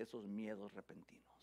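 soft breathy whisper. Acted. Preacher whispering about God's enemies and sudden fears. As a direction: lean in and whisper it darkly.

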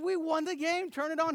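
high excited announcement; excited shout. Retold or imitated. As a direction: friend on phone told the win, excited high shout. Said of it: "I said".

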